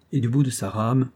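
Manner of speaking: usual speaking voice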